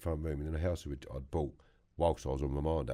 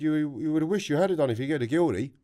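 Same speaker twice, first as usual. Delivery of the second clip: mock Irish accent, higher and a bit louder; imitating an Irish mate's warning, roughly; bad Irish voice; 10 semitones higher, 8 dB louder than usual. Irish mate Danny warning him about a guilty verdict, rough mock Irish accent. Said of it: "It's not a very good Irish voice".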